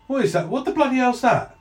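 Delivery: high-pitched, alarmed guard voice; baffled guard blurting it out; startled guard. Acted. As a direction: play a guard spotting something odd. Guard notices an invisible intruder.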